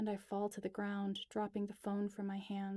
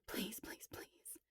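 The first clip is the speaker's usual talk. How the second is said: breathless whispered begging, fading away; desperate whisper; 9 dB quieter, slower than usual. Acted. Alex redialing Elias, whispering a desperate plea. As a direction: plead under your breath.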